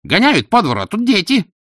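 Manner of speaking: loud, high complaint; indignant bystander. A narrator's reading. Angry bystander in the crowd.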